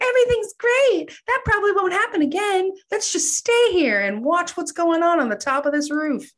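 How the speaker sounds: high, airy, falsely cheerful; mock carefree. Ghostbusters on the roof, shrugging off the sinkhole, mock carefree and high. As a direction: play it breezy, as if nothing could go wrong.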